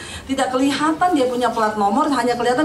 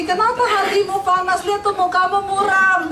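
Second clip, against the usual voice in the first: God questioning Cain; 6 semitones higher than usual. Retold or imitated.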